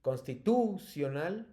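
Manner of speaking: "Constitucional" slow, TU stressed, lifting at the end; "Constitucional" said as constiTÚcional, questioning. Shown as a demonstration. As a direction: lean on TU in "Constitucional" as if asking. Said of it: "te las voy a pronunciar todas con el acento fuerte".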